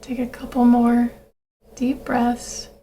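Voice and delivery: calm breathing-exercise voice with long pauses; guided meditation. Acted. Leads a grounding breathing meditation.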